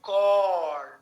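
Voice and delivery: corn, long rounded or. Shown as a demonstration. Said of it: "minimal pair drill".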